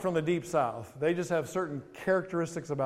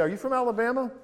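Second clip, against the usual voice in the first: mock-surprised question, pitched high; teasing question; 9 semitones higher, 4 dB louder, faster than usual. Pastor teasing a New Yorker with a high, mock-surprised question. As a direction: tease the speaker by guessing wrong. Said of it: "I'll ask them, I'll say".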